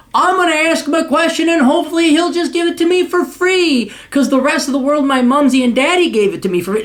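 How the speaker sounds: high, whiny mock of a freeloading kid; mock entitled millennial. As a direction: sneer at a spoiled kid's wishful thinking. Mocking a millennial's thoughts.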